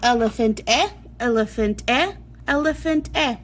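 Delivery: "eh" as in "elephant", short and clear; short e sound. Shown as a demonstration. Teacher showing kids the short e sound, exaggerated. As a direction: stress the "eh" before "elephant". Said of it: "And you're gonna say".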